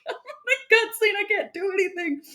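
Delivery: mock helpless. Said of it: "It's like".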